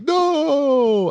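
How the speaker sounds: mock the hero's high cry; mock yell of no. Mocking the hero's cry for his friend, a high yell. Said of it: "But he says it like that".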